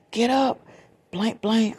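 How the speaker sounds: loud, high retold calling out; urgent wake-up call. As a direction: imitate her rousing a sleeper in alarm. Goddaughter urgently waking the cousin.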